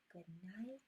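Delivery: ordinary delivery, nothing marked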